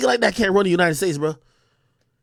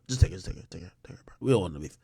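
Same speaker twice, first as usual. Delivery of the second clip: hushed, desperate whisper; pleading whisper; scared pleading whisper; 14 dB quieter, much faster than usual. Retold or imitated. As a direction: whisper it like you're begging for mercy. Russians begging in acted quote.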